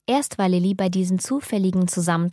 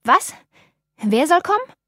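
surprised; 8 semitones higher, 6 dB louder than usual. A narrator's reading. Startled question, high far voice.

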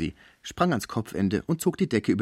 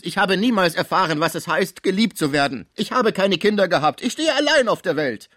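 put-on higher, louder despairing old man; lamenting invalid; 9 semitones higher, 6 dB louder than usual. A narrator's reading. Sick Pons lamenting to the concierge, raised and despairing. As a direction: pour out his loneliness.

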